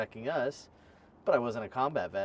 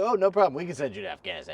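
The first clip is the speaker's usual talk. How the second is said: high, loud, breezy and overeager; eager recruiter voice; 7 semitones higher, 8 dB louder, faster than usual. Retold or imitated. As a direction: play a chirpy salesman recruiter. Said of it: "the Army's like".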